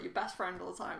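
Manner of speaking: ordinary delivery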